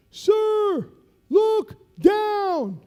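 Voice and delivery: slow, high, loud shouted command; sergeant's order. Retold or imitated. Master sergeant ordering lieutenant to the ramp, shouted. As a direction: bellow it slowly over the wind.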